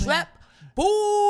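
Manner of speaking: high, loud hype-man shout of the name. Pretend.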